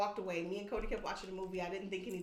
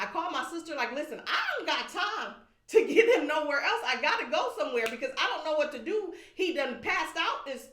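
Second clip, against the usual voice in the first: agitated retelling; 7 semitones higher, 8 dB louder than usual. Real, not acted. Wife telling the host how she panicked on the phone, agitated and loud. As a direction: voice higher and louder, urgent.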